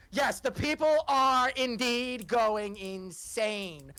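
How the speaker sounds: slow, grandly stretched delivery; dramatic announcing. Acted. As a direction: draw every word out with relish. Game master announces roll result.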